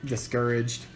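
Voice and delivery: usual speaking voice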